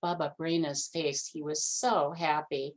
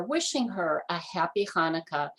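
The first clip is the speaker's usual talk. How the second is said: ordinary delivery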